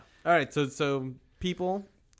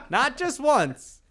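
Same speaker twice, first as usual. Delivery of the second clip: much higher, slow, laughing through it; 11 semitones higher, 5 dB louder, much slower than usual. Real, not acted. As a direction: push the punchline out through real laughter. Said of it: "laughing punchline".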